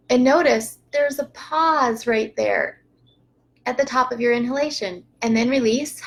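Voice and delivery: calm breathing-coach voice guiding each breath; unhurried breathing instructor; guided breathing. Shown as a demonstration. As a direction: talk them gently through inhale, pause and exhale. Leads a breathing practice.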